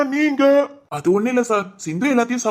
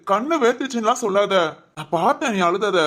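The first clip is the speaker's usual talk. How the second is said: normal talking voice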